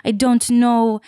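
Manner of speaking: high-pitched, badly faked Russian-ish accent; improvised bad Russian accent; vaguely Russian accent. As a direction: put on a muddled Russian-Israeli accent. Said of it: "Little bit of a Russian vibe".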